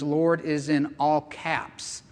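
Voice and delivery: ordinary talk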